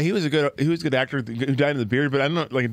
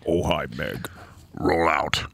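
very low hero voice; hammed-up deep command; mock movie hero; 10 semitones lower than usual. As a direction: play a robot-movie leader, deep and low. Plays Transformers-style hero.

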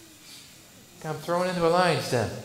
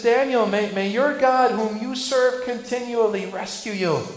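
raised, pleading blessing from above; king's plea; 6 semitones higher, 8 dB louder than usual. Retold or imitated. King Darius calling down to Daniel, loud plea. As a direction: call it down into the pit, loud and earnest. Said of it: "and he says".

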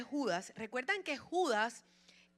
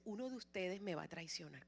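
much softer, grave and hushed; soft Jesus voice; 4 semitones lower, 10 dB quieter than usual. Acted. Jesus telling his disciples at supper, hushed and grave. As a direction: say it quietly, like a heavy secret.

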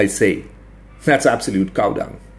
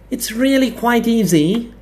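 high, slow, lecturing professor voice; pompous academic; 12 semitones higher than usual. A narrator's reading. Narrator voicing the stout academic lecturing a room, high and pompous. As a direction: play the smug expert explaining. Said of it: "the stout, bespectacled academic announced".

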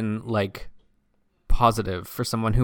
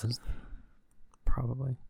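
whispered; 9 dB quieter than usual. Real, not acted. Muttered aside while searching tweets.

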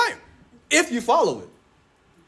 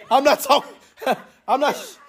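ordinary talk, nothing marked

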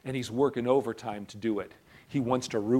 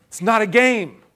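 urgent warning; 8 semitones higher, 9 dB louder than usual. Real, not acted.

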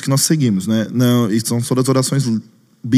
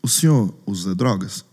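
low, slow, softer questioning; stern policeman; 6 semitones lower, 6 dB quieter, slower than usual. Retold or imitated. Policeman at the table questioning storyteller, grave. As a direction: play an officer calmly interrogating. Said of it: "olha pra mim e fala assim".

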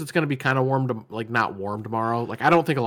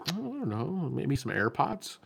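mock coy guess; 4 semitones higher, 9 dB quieter, much slower than usual. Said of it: "And he's like".

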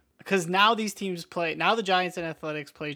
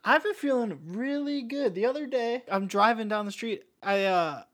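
higher-pitched pumped-up gym bro; roided-up bro voice; 6 semitones higher, 4 dB louder than usual. Acted. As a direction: brag like a juiced-up lifter.

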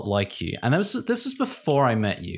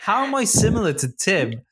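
puzzled self-quote; 7 semitones higher than usual. Retold or imitated. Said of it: "I'm still like".

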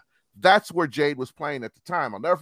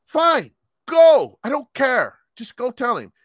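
much higher and louder, fed-up snap; annoyed outburst; 9 semitones higher, 9 dB louder than usual. Retold or imitated. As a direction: throw it at her, irritated. Snapping at the supervisor.

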